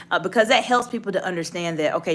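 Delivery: usual speaking voice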